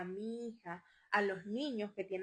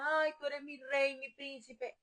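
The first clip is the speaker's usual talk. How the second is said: doting mother; 4 semitones higher, slower than usual. Retold or imitated. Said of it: "una cosa es que tú le digas".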